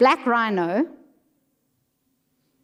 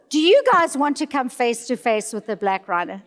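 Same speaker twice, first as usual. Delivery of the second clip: usual speaking voice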